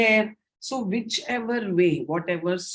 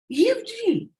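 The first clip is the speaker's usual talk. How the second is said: high, exclaimed letters; exclamation. Shown as a demonstration. Teacher showing how to read when an exclamation mark follows, high and exclaiming. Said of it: "when there is an exclamation mark".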